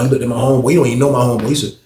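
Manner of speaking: usual speaking voice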